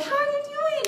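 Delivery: very high, sing-song hello; mock cheery greeting. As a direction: greet them with exaggerated sweetness. Acted cheery greeting between acquaintances.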